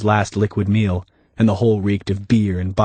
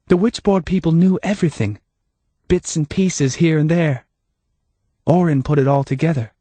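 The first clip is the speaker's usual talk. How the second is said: higher, lightly voiced Hannah; Hannah, calm and knowing; 7 semitones higher than usual.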